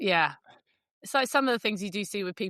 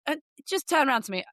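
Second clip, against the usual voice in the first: ordinary talk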